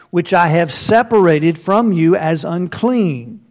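King James read with weight; scripture reading. Acted. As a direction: proclaim the verses like a preacher. Reads scripture aloud.

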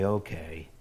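father quietly granting permission; soft reply. Retold or imitated. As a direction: give in softly like a father. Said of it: "replied softly".

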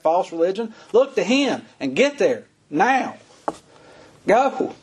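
fiery Southern preacher in full flow; full-force preaching. Acted. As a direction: preach it at the top of the voice, urging the crowd.

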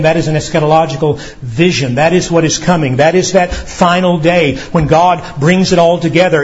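ordinary delivery

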